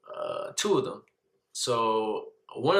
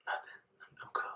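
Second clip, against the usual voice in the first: tiny hushed denial; near tears; 14 dB quieter than usual. Retold or imitated. Scared son brushing off mom, hushed near tears. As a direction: barely get it out, almost whispered. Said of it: "on the verge of tears".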